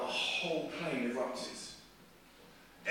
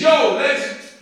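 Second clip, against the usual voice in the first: jokey high, booming announcement; mock pilot shout; 11 semitones higher, 17 dB louder, much slower than usual. Preacher jokingly imitates the pilot on the intercom, mock shouting. Mimicking pilot, then corrects himself.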